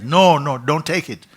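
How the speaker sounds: high, loud warning; urgent warning shout. Acted. Cave voice warning the seeker, shouting. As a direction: stop him with a loud, high cry.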